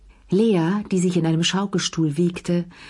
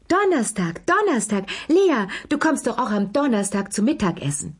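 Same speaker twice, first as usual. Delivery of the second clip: high-pitched, fluttery reminder; effusive hostess in a high voice; excited insistence; 5 semitones higher than usual. A narrator's reading. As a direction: pipe it up high, eagerly pressing the invitation. Excited hostess pressing Lea to come.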